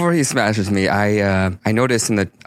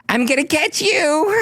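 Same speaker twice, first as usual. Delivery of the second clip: playful battle cry. Acted. Enacting lunge at goblin.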